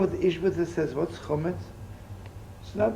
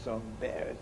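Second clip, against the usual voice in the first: quietly, shrinking into the words; a hint of an embarrassed mumble; meek and embarrassed; 4 semitones lower, 9 dB quieter than usual. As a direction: play a timid rabbi who brushes off the praise. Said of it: "And he talks like that".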